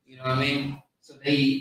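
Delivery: ordinary talk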